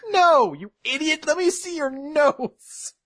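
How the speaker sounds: high-pitched, drawn-out mock exasperation; exasperated scolding. Teacher correcting the confused student, exasperated. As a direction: scold him high, stretching the end.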